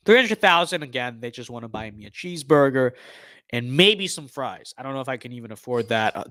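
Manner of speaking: normal talking voice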